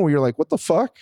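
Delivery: normal talking voice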